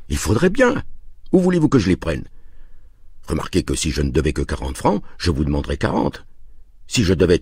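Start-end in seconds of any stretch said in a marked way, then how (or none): 0.1-2.2 high-pitched, exasperated character voice; cornered dealer pleading his case, much higher; exasperated protest. A narrator's reading. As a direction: protest helplessly, pitch leaping up. Cornered dealer answers an indignant buyer.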